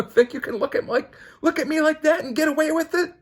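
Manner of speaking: very high, loud challenge; shrill cartoon villain taunt; squeaky defiance. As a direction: squeal the dare right back at him. Voicing a defiant character.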